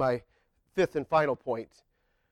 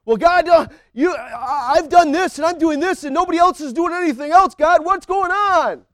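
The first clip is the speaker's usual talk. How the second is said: whiny mock prayer; 11 semitones higher, 8 dB louder than usual. Preacher imitating a frustrated believer griping to God, high whine. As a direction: complain to God like nobody else pulls their weight.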